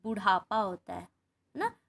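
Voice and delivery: ordinary delivery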